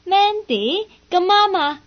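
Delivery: startled, then put on a friendly front; surprised greeting. Greets rival Mandy with forced surprised cheer.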